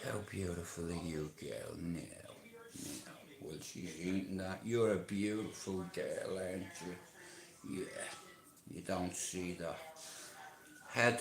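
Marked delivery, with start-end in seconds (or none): 0.0-3.1 much softer, near-whisper soothing. Shown as a demonstration. Man soothing a mare while she eats, soft hush. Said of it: "I go up to you and I say". 4.6-8.7 much softer, slow fond murmur; soft praise. Shown as a demonstration. Man praising a horse as she eats, soft murmur. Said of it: "I go up to you and I say".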